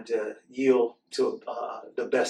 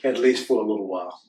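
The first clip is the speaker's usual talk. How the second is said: lively storybook read-aloud; reading to children; 5 dB louder than usual. Acted. Host reading a book to children, animated storytelling. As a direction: read the picture book to the kids with expression.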